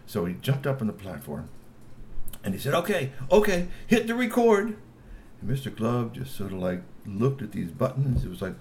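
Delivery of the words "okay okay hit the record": high eager G P voice. A narrator's reading. G P on the platform.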